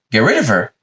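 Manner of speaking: mock disbelief, thrown back as a question; incredulous echo. God answering Adam's suggestion, lightly incredulous. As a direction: echo it as if mildly appalled.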